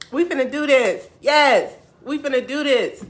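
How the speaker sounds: high, loud hype cheer; all-in excited determination; fired-up resolve. Retold or imitated. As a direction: shout it like a pep talk. Retold resolve at peak.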